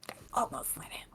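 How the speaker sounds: breathy hushed whisper of a confession; whispered aside. Real, not acted. Whispering to the co-host that she almost let him. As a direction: drop to a secret whisper.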